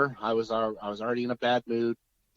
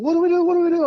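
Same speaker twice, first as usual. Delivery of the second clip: mimicking a frantic father, much higher and louder; panicked dad; 12 dB louder, much faster than usual. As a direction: squeal it out in a mock panic. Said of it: "he's in a panic".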